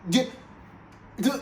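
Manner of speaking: ordinary talk, nothing marked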